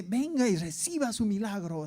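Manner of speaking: mock radio ad for miracles, swooping melody; radio announcer imitation. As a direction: mimic the advert's showy call. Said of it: "pero era así". (imitando al locutor de un anuncio)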